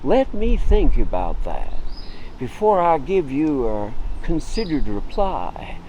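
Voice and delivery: very slow, drawn-out ponderous reply; laconic. Acted. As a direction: take all the time in the world over each word. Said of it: "they may be very laconic".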